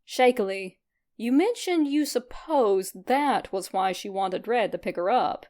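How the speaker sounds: usual speaking voice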